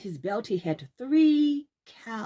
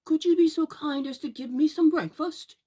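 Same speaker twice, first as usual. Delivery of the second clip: put-on polite boy; 6 semitones higher, 5 dB louder than usual. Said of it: "quite polite".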